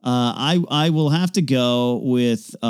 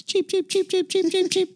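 high-pitched chick peeping; squeaky little bird cheeps; baby chick peeps. Pretend. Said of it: "do birds make that sound?".

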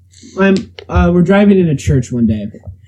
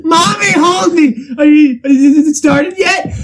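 high, slow, whiny plea; mock scared child; 8 semitones higher, slower than usual. Scared child begging mommy, high and whiny. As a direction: beg mommy like a scared kid, hamming it.

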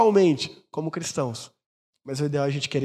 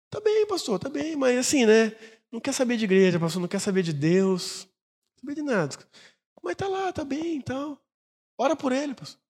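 higher-pitched mother; 6 semitones higher than usual. Retold or imitated. Mother reports son left church.

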